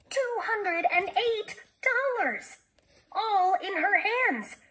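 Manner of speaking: high, slow, ringing amazement; amazed exclamation. A narrator's reading. Jessie marvelling at the sum in her hands, exclaiming. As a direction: exclaim it high, stretching each word in wonder.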